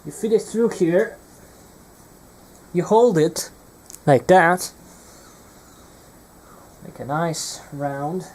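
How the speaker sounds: usual speaking voice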